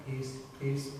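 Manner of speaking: gentle Quaker pleading, low and hushed; Quaker peace. Retold or imitated. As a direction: murmur "Peace, peace" low and soft like a meek Quaker. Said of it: "or the Quakers very much will be going".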